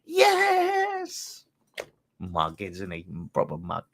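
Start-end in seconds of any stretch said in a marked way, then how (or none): 0.1-1.4 squeaky strangled high "Yes!" held in the throat; mock quiet scream. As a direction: replay the squeal, very high and pinched. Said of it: "You said you weren't gonna scream".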